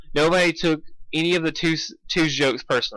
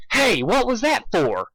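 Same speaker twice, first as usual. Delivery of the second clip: startled yelp; 8 semitones higher, slower than usual. Acted. Spike startled after prank.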